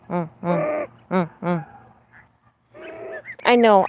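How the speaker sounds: usual speaking voice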